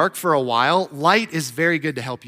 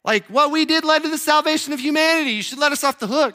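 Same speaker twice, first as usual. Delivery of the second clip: much higher, wheedling plea; a cheeky ask to be let off; mock plea; 11 semitones higher than usual. Pilate and Judas asking Jesus to let them off, mock-wheedling. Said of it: "all coming up to Jesus and be like".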